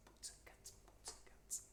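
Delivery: "boots and cats" whispered as a beatbox rhythm. Shown as a demonstration. To the choir, demonstrating the U sound: whispered "boots and cats". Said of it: "or boots and cats".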